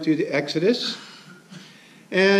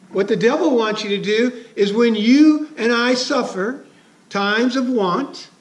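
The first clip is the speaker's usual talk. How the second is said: ordinary delivery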